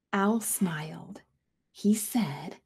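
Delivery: soft slow whisper. A narrator's reading. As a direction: whisper gently. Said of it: "whispered narration".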